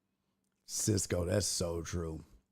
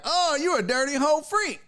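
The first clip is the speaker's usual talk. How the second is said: higher, mocking judgmental jab; mock the judgy boyfriend, pitched up; mock insult; 12 semitones higher than usual. What not to say to your girl, a higher mocking jab. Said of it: "without being like".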